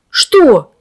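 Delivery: sharp alarmed cry; shout. A narrator's reading. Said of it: "закричал Лёша".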